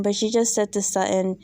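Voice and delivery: ordinary delivery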